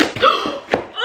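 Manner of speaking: high breathy gasp of surprise; sharp excited gasp. Real, not acted. Opening a publisher's package, a sharp gasp. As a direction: gasp at the reveal.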